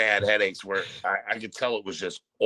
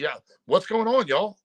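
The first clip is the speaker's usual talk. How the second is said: mild, higher Southern twang on the greeting; Southern accent; 9 semitones higher than usual. Retold or imitated. Caller imitating Melissa's phone greeting, in a light high Southern accent. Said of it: "she gets on the phone like Daisy Duke".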